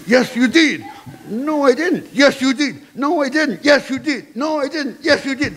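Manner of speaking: high, back-and-forth bickering; escalating squabble. Acted. Student and head bicker over the class, escalating. Acted escalating did and didn't quarrel.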